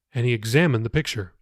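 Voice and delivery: usual speaking voice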